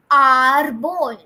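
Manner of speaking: arbol with a long, drawn-out first A; stretched A. Shown as a demonstration. Teacher modelling Spanish arbol for students, stretched A. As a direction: stretch the opening A of arbol loudly. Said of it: "to stretch the A".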